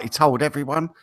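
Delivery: ordinary talk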